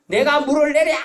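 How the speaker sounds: high, loud shout of Moses in anger; angry outburst. Retold or imitated. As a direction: fling out the words in fury. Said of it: "이러면서 화를 냈거든요". (모세처럼 높고 크게 화내며 외침)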